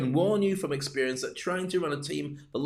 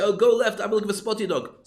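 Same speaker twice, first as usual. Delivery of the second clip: excited tourist; 6 semitones higher, 5 dB louder than usual. Acted. Acted tourist directing phone tour.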